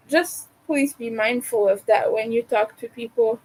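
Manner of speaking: normal talking voice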